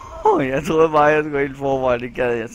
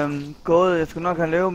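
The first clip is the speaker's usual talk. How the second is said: normal talking voice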